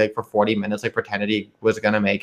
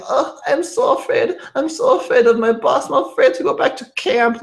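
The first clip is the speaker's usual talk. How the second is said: mock scared Russian girl; 14 semitones higher than usual. Mocking the actress who played a scared Russian girl, high and giggly. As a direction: squeak it out like a pretend-helpless girl. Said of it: "pretended to be this, like, Russian girl".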